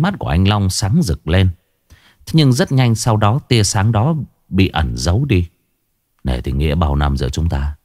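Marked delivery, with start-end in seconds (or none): 6.2-7.8 lightly voiced husband offering a deal; calm gang boss. A narrator's reading. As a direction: keep it cool and in control. Long speaks to his wife.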